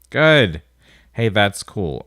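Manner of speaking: normal talking voice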